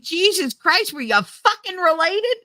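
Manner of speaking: high-pitched exasperated outburst; incredulous, irritated retort; retold irritation. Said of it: "I got so irritated".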